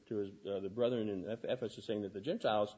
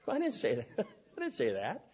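high-pitched denial breaking into laughter; laughing protest; 6 semitones higher than usual. Retold or imitated. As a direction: protest it, amused and incredulous. Protests misquote while telling story.

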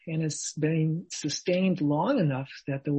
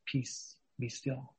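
whispered, much softer calm command; hushed calm command; 3 semitones lower, 10 dB quieter, much slower than usual. Acted. Jesus speaks to the waves, soft whispered command. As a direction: whisper it to still the sea.

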